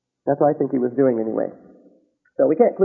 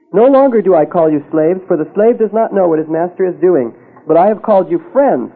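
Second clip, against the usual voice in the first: louder, forceful scripture reading; preaching scripture; 6 dB louder than usual. Acted. Pastor proclaims Jesus's words to congregation, forceful. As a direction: proclaim the verse to the hall.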